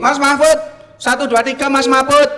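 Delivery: loud mic check call. Real, not acted. Mic check calling remote guest.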